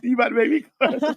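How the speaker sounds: fast, laughing tease. Real, not acted. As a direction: laugh through the line. Laughing while teasing.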